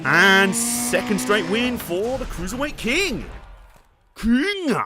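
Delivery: drawn-out victory call, rising high on "King"; mock announcer. Player crowning his driver after a second straight win, mock announcer. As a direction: announce the champion slowly, swooping up at the end.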